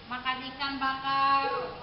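ordinary delivery

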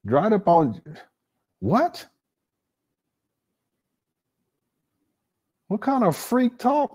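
high-pitched, stretched incredulous questions; appalled disbelief. Real, not acted. Host reacting to the replayed crude line, appalled. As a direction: pitch it way up, grossed out and baffled.

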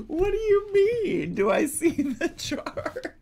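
high, loud, laughing disbelief; incredulous. Real, not acted. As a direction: ask it in total disbelief. Incredulous outburst.

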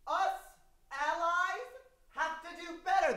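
high, hushed, pious ally; earnest ally. Retold or imitated. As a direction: do a solemn, preachy ally. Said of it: "Here's the very first impression".

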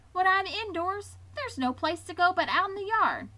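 a bored little pig grumbling about being stuck; whiny young piglet. Acted.

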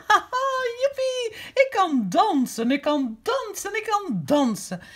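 a jubilant giraffe crowing that he can dance; joyful shout. Acted. Grandma voicing Gerard's joy for Rozemieke, high excited cry. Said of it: "riep hij uit".

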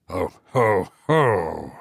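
low, distant echoing ho ho; eerie echo voice. A narrator's reading. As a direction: echo it back from the dark. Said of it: "repeated a voice close beside him".

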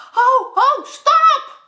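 high, loud alarmed shout. Acted. Ad warns Job, loud alarmed shout. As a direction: yell at him to stop. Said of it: "schreeuwt Ad".

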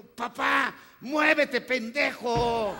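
high, loud yell with the last word stretched; kid's yell. Retold or imitated. A boy at a match yells at his father to move, loud. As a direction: shout it like an over-excited boy at a match.

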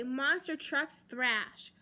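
usual speaking voice

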